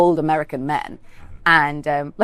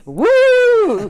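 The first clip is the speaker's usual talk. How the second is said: high, loud whoop; cheering; 7 dB louder than usual. Retold or imitated. Writer cheering her producer on, triumphant whoop. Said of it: "I remember being like".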